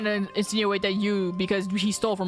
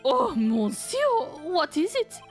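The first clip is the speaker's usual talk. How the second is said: nervous high French chef voice; French accent; 6 semitones higher, slower than usual. Acted. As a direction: ask warily in French accent.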